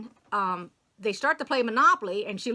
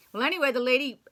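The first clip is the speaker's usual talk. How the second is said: normal talking voice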